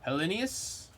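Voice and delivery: Hellenius stretched out grandly with extra syllables and a rising end; Shakespearean-style name. Shown as a demonstration. Streamer hamming up a card name like Shakespeare. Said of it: "I'm saying it like it's, um, like a Shakespearean name".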